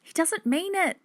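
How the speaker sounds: put-on high-pitched girl's voice; high, protesting little voice; reassuring child. A narrator's reading. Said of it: "said Hannah".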